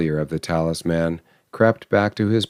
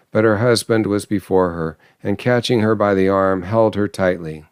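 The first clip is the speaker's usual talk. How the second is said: ordinary delivery, nothing marked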